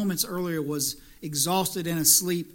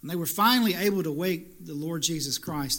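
usual speaking voice

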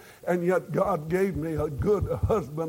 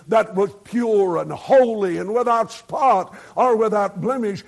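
rising, louder preaching push; fervent preaching; 5 semitones higher, 6 dB louder than usual. Acted. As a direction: exhort the congregation with full force. Preacher builds to climax.

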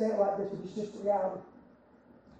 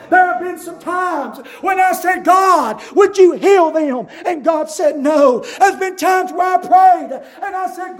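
loud, rhythmic preacher's call; full-force preaching; 5 semitones higher, 8 dB louder than usual. Acted. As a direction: preach it out to the congregation.

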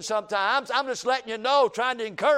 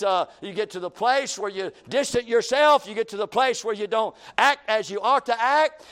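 ordinary talk, nothing marked

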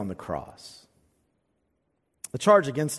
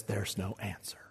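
unvoiced, hushed whisper; 6 semitones lower, 14 dB quieter than usual. Preacher hushing the psalm's bleak answer, whispering. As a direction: breathe it out as a whisper.

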